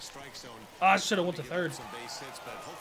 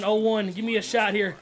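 usual speaking voice

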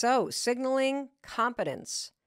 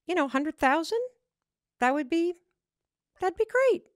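salary ask turned into questions, rising on "great"; raised pitch, "great" lifting as if asking permission; uptalk; 7 semitones higher than usual. Acted. Acts out uptalk in job interview.

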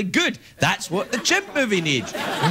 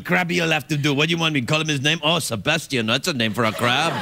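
put-on light French accent, defensive; lightly voiced Cousteau insisting on Krabby; Jacques Cousteau impression; 3 semitones lower than usual. Still as Cousteau, defending the name, light French accent. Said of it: "best Jacques Cousteau impression".

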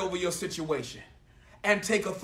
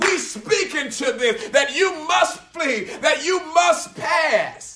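forceful, drawn-out preacher shout; full-force preaching; 4 semitones higher, 5 dB louder than usual. Real, not acted. Preacher rallying believers to speak to mountains, full-force preaching. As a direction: build to a commanding preacher's shout.

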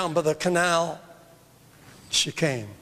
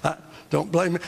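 normal talking voice

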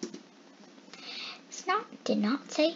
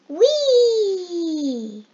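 high, louder, long whee of joy; gleeful whee; 7 semitones higher, 7 dB louder than usual. Acted. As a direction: squeal it like a ride. Snot floats, joyful cry.

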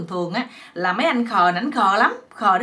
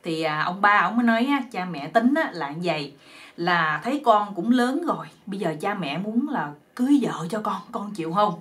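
ordinary delivery, nothing marked

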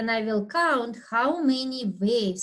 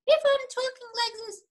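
much higher, fast and squeaky; pitched way up, quick, to show a high voice; high pitch demo; much faster than usual. Shown as a demonstration. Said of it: "That will be a different pitch".

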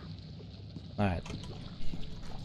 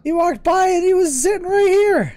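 high loud complaining; indignant outburst; 8 dB louder than usual. Real, not acted. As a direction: protest it loudly. Indignant after getting caught.

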